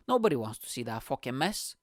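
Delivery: ordinary talk, nothing marked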